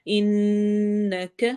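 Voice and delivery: انک held very long, nun hummed through the nose; nasal ghunna, انک stretched slowly. Shown as a demonstration. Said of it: "نون پہ غنہ کرنا ہے".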